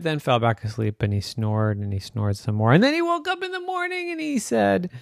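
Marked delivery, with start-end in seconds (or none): none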